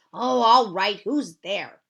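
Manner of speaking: loud, fed-up grumble; grouchy boy. Acted. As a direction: give in grudgingly, annoyed. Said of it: "he grouched".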